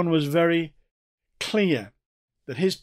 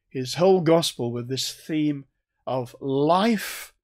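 ordinary talk, nothing marked

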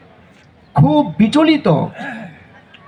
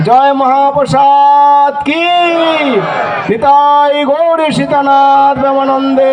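jubilant calls; 6 semitones higher than usual. Real, not acted. Closing jubilant calls to the crowd.

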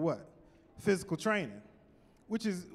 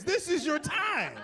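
slow, higher shout of encouragement; mock pep shout; 7 semitones higher, slower than usual. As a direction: yell it like a coach. Urging horror victim to run.

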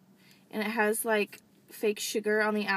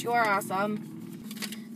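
slow, sincere compliment to the sender; mean it, say it slowly to her; slow heartfelt thanks; slower than usual. Real, not acted. Telling the viewer directly.

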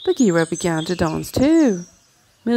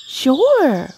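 high, louder cheerful sure; cheerful boy; 8 semitones higher, 5 dB louder than usual. Acted. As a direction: toss it off brightly, much higher. Mowgli cheerful answer.

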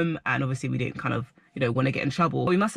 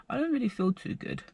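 queasy and quiet; 7 semitones higher, 6 dB quieter than usual. Retold or imitated. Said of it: "And then I was like".

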